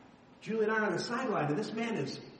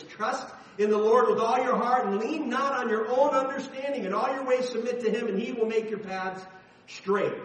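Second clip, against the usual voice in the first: raised, emphatic reading of scripture; louder scripture reading; 5 semitones higher, 6 dB louder than usual. Real, not acted. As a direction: proclaim the verse, louder and higher.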